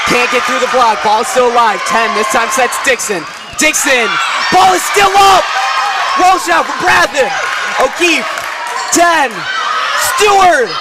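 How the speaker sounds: rising excitement through the rally; live play-by-play. Acted.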